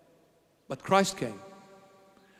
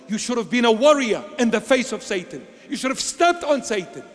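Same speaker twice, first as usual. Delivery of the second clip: fiery rebuke; 6 semitones higher, 4 dB louder than usual. Real, not acted. Preacher rebuking fanatics on their high horses, loud and high. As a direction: rail at them from the pulpit.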